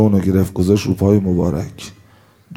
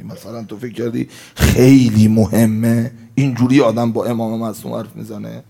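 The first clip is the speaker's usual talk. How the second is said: ordinary talk, nothing marked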